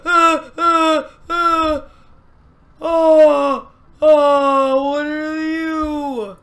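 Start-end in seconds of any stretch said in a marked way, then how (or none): none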